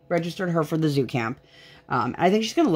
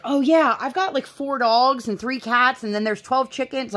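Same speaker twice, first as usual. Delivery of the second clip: casual pet owner; 8 semitones higher than usual. Retold or imitated. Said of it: "and they're like".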